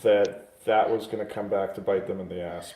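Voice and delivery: ordinary talk, nothing marked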